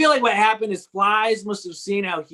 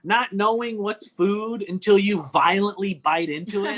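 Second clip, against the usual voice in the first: ordinary talk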